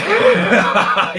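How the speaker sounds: high burst of real laughter; loud laugh. Real, not acted. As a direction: break out laughing loudly. Laughs at his own story.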